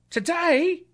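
put-on very high boyish voice, astonished; high, rising boy's query; street boy. A narrator's reading. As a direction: answer as an incredulous lad. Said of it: "replied the boy".

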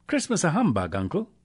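high, lightly voiced young relative's protest; cheerful disbelief, starting high; incredulous nephew. A narrator's reading. Nephew questioning his uncle's humbug, incredulous.

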